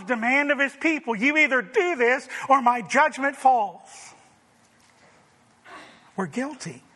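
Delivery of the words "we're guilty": much softer, sinking "We're guilty"; soft confession. Real, not acted. Preacher to congregation, soft sober confession. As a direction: let "We're guilty" drop to a hushed, falling close.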